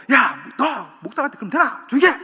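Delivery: loud scolding of the pastor's son; scolding shout. Retold or imitated. Preacher acting out a scolding of the pastor's son, loud. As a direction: bark it at the noisy kid. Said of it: "목사님 딱 보고".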